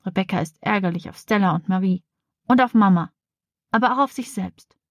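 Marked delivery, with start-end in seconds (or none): none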